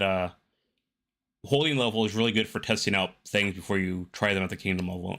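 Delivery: ordinary talk, nothing marked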